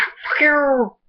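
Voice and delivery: very high mock-shouted cat voice; voicing the cat yelling. Vlogger voicing the cat swearing at him, high mock shout. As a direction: screech it like an angry cat. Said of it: "He's like".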